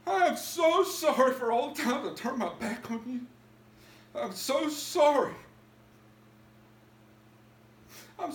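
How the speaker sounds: a repentant man crying out to God; pleading prayer. Retold or imitated. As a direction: beg forgiveness, voice rising. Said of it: "I said, "Please, Lord".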